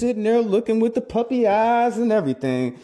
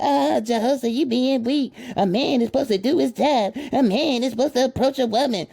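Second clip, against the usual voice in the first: lightly voiced scolding woman, a bit higher; mocking sisters; 5 semitones higher than usual. Host mimicking complaining sisters, mildly higher mock voice. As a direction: mimic the sisters nagging the men. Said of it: "And some of my sisters".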